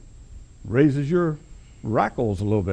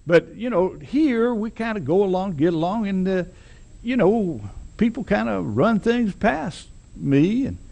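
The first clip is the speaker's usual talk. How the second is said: ingratiating boss man; 6 semitones higher than usual. Retold or imitated. Preacher voicing the big boss sweet-talking the newcomer. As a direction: sweet-talk him like a small-town boss who owns the place. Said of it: "just as ingratiating as he can be".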